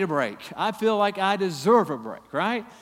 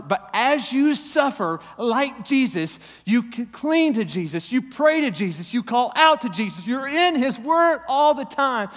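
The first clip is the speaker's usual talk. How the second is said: louder, higher preaching build; preaching at full force; 6 semitones higher, 6 dB louder than usual. Acted. Pastor urges suffering spouses to cling to Jesus, fervent preaching. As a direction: drive it home with fervor.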